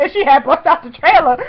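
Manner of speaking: high, loud gushing; giddy loud rambling; excited babbling. Retold or imitated. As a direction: blurt it out, too thrilled to make sense. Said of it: "looked like a babbling idiot".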